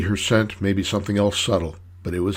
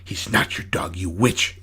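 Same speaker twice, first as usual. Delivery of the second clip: hushed, venomous retort; spat out; 2 semitones lower than usual. A narrator's reading. Annie defies the woman claiming the dog, spitting it out. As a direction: spit it out with little voice. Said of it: "Annie spat".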